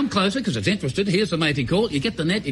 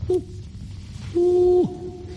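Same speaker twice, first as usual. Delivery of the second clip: distant bunyip reply; 10 semitones higher than usual. Retold or imitated. Hunter imitating the bunyip's answer from far off, high hoot. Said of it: "far off in the distance".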